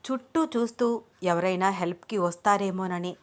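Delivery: ordinary delivery, nothing marked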